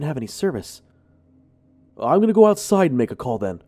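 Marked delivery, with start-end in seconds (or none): 2.0-3.6 loud, fast yell. Acted. Junior yelling as he runs off to call, loud and fast. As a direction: shout it while bolting away. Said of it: "Junior yelled".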